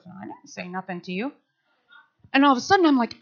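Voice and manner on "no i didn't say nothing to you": very soft, low, quick denial; hushed reply. Retold or imitated. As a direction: whisper back a puzzled denial.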